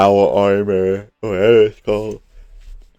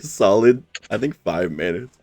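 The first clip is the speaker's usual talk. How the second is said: ordinary talk, nothing marked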